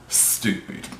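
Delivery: breathy unvoiced whisper of Stupid. Real, not acted. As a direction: whisper Stupid under your breath in disgust. Disgusted repeat of stupid.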